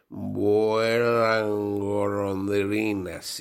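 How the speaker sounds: very slow, drawn-out password; slurred, drawn-out password; slow tipsy password. Acted. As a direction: drag out the code phrase after drinks. Nervous man says password.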